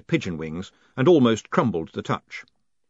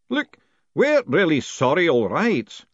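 hint of an apologetic old man, higher; lightly voiced apologetic worshipful master, raised pitch; 6 semitones higher, 5 dB louder, slower than usual.

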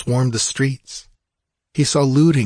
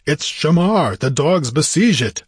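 higher-pitched warrior's outcry; alarmed exclamation; 6 semitones higher, 4 dB louder than usual. A narrator's reading. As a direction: cry out at the sight of the attack. Conan sees his town besieged.

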